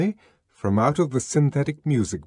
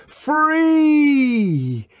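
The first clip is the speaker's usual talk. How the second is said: high, loud ringing cry; 6 dB louder than usual. A narrator's reading. Savage yelling to the crowd while fighting. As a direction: fling the word out to the mob. Savage shouting during riot.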